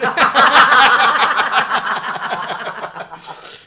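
bursting out laughing; big hearty laugh; loud laughter. Real, not acted. Hearty laugh at salmon joke.

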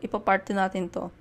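normal talking voice

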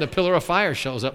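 usual speaking voice

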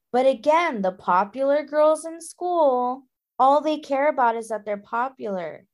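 normal talking voice

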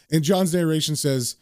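ordinary delivery, nothing marked

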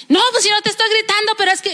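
high, fast, angry northern-Mexican retort; imitating angry norteño. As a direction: play an angry norteño husband denying it in a rush. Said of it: "y, y enojado, deje usted que, que hablara como norteño, estaba enojado el hombre". (norteño enojado, imitado)

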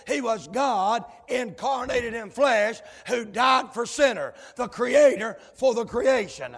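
loud, shouted preacher's cadence; fiery pulpit preaching; preaching at full force. Acted. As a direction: hammer each line home to the pews.

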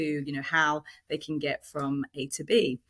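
normal talking voice

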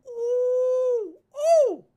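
mock ooh. Mocking drug currency market.

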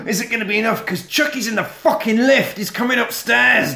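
high, urgent storyteller; excited suspense. Retold or imitated. Telling co-host Chucky is coming upstairs, excited and high. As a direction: build panic as the danger climbs. Building suspense in retold chase.

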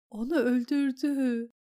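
trembling voice. Acted. Battered lady tells the queen of the killing, trembling. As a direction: let the voice quiver as the words come out. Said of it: "dedi titrek bir sesle".

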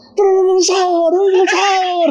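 high, drawn-out sahur chant; high mock calling; imitated sahur callers. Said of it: "kalau sing sahur".

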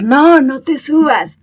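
patient but firm correction; firm slow no to the puppy. Retold or imitated. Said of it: "vas y le dices".

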